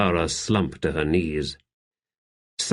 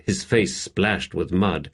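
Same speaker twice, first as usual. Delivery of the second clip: ordinary delivery, nothing marked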